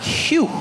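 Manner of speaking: high whistled sigh of relief; sudden high exhale of relief; relieved phew. Acted. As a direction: let out a big phew. Relief at spotting information man.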